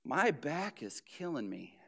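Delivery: soft, low, put-on suffering; mock complaint. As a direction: fish for sympathy with a groan. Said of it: "they'll be like".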